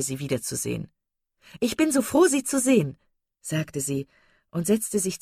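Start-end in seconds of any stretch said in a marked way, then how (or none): 1.6-3.0 high-pitched, gushing delight; overjoyed. Acted. Mrs Palmer greeting the young ladies she is glad to see, overjoyed. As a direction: greet them as if beside yourself with joy. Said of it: "war außer sich vor Freude".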